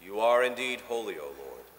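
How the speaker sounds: normal talking voice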